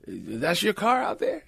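high, slow imitated sheriff; nosy local lawman impression; small-town sheriff. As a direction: question it slowly, pitched well up. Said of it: "he turns around and goes".

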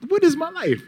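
high mock-bewildered cry; disbelief. Real, not acted. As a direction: marvel at your own luck. Disbelief at fame.

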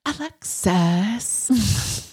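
very drawn-out affected sitcom voice; Schitt's Creek accent. Retold or imitated. Showing off to co-host, slow affected impression. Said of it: "I can do Schitt's Creek accents".

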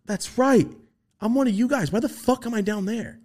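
realization. Retold or imitated. Retold memory returning.

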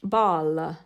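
Italian accent with an added vowel, ball-a. Shown as a demonstration. Mother showing how Italians say Ball with an extra vowel, Italian accent. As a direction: say Ball the Italian way, tacking on a little uh. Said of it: "typical Italian accent".

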